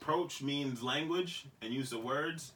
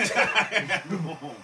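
laughing; 4 semitones higher, 7 dB louder, slower than usual. Retold or imitated. Retelling himself drunk, laughing.

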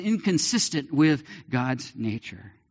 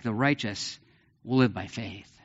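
solemn; 4 semitones lower than usual. Acted. Key verse repeated.

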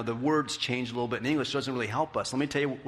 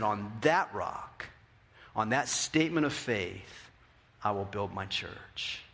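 lower, softer and slow; solemn promise; 7 semitones lower, 4 dB quieter than usual. Acted. Pastor voices Jesus' promise softly and solemnly. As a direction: speak it like a sacred vow. Climactic quote slowed and softened.

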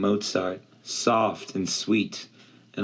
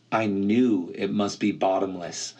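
ordinary delivery

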